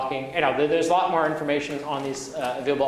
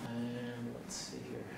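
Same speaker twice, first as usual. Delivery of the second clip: very soft, slow, lower aside; muttering to self; 5 semitones lower, 20 dB quieter, slower than usual. Real, not acted. Lecturer to self while finding the next demo, muttering. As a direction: think aloud under your breath.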